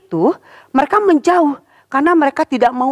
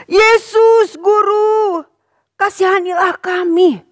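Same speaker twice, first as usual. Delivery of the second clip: crying out; 8 semitones higher, slower than usual. Acted. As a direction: cry to Jesus from afar. (seruan memohon belas kasihan)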